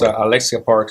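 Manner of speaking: ordinary delivery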